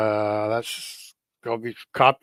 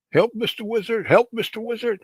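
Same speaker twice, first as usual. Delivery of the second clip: high-pitched cartoon plea; cartoon turtle calling for help; 10 semitones higher than usual. Acting out cartoon turtle's cry for help.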